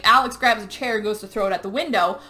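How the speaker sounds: ordinary delivery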